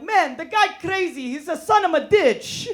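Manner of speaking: high, loud mock fury, words mangled; mock angry cousin. Cousin cursing wrong, hammed-up high mad voice. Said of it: "He'd be like".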